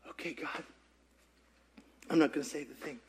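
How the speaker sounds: very soft, low, giving up; hushed surrender. Retold or imitated. Preacher giving in to God, barely above a whisper. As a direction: murmur the surrender.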